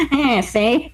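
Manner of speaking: put-on old-time gangster voice, see; nasal-free mock mobster drawl; Penguin gangster voice. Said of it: "is that how Batman talks".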